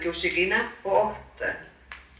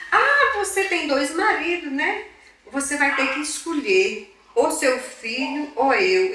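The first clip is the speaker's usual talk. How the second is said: imitating an angry husband, higher and drawn out; retold anger; 8 semitones higher, 4 dB louder, slower than usual. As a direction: throw a slow, raised-voice ultimatum, acted. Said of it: "ele tava realmente com raiva". (imitando o marido com raiva)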